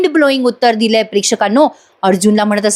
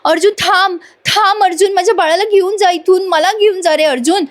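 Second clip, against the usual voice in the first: much higher, begging cry; retold desperate cry; 8 semitones higher than usual. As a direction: plead as he walks away, acted for the retelling. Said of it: "Priya मोठ्याने ओरडत राहते".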